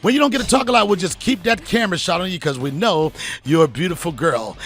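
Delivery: loud, booming announcer outro; show host sign-off. Acted. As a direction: close the show like a hype host.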